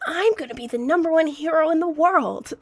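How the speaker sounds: a mild cringey dub hero line; overacted shonen hero, a touch high; cringe anime dub. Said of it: "a cringe anime dub".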